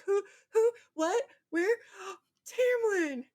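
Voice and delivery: play-acting waking up confused and calling out; fake dazed waking. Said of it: "And she's like".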